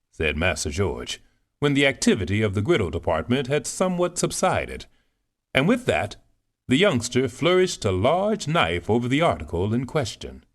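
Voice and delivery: plain reading voice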